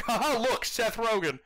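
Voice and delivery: high, jokey "look, Seth Rogen"; mocking the movie. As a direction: pitch it up like the film nudging you. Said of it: "played up that it's like".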